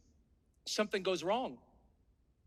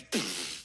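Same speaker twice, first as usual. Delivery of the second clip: slap sound; 6 dB quieter than usual. Retold or imitated. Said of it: "and he goes".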